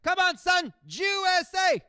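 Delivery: high, loud chant; hammed-up sports dad chant; imitating a yelling dad. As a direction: cheer it at the TV like a fan. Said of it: "He's yelling at the screen".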